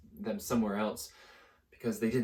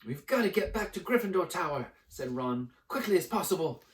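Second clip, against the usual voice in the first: put-on higher, hurried urgent boy; 7 semitones higher than usual. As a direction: urge them to hurry. Ron urgent.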